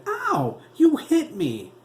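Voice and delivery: high, loud hurt yelp; boy yelping after his mother slaps him; crying out in pain. A narrator's reading. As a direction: cry out as if just struck. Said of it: "Teddy cried".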